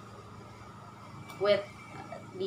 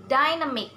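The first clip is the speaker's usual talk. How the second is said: DYnamic, first syllable higher and falling away; stress on first syllable; 4 semitones higher, 4 dB louder, faster than usual. Shown as a demonstration. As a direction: punch the DY in "dynamic". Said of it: "first syllable".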